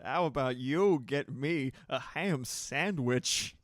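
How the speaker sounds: slow, loud, oddly paced Walken line; Walken impression. Streamer rereading chat's quote in slow, loud Walken voice. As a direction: drag out each word in a loud deadpan Walken voice. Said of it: "Moxie perfectly typing it out in chat".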